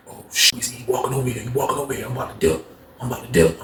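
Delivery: low, quiet aside to a friend; hushed, low-pitched warning. Acted. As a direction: mutter a nervous heads-up under your breath. Roommate warns him under breath.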